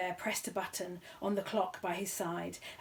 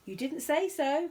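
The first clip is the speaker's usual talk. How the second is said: much higher, louder audience shout; heckler; 8 semitones higher, 6 dB louder than usual. A narrator's reading. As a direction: call out from the crowd. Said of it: "cried a voice in the audience".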